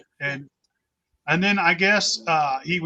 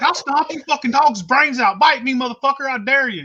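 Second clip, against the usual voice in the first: loud, higher furious shout; furious yell; 7 semitones higher, 5 dB louder than usual. Retold or imitated. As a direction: roar a dare at the dogs. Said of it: "It's like".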